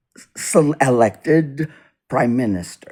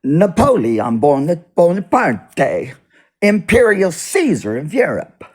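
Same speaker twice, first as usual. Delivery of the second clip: loud, high, grandiose imperial proclamation; Emmanuel Macron; 7 semitones higher, 6 dB louder than usual. Retold or imitated. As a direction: proclaim it like a self-crowned emperor, hammed up. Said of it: "That's how he talks".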